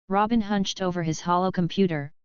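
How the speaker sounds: usual speaking voice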